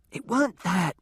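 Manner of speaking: usual speaking voice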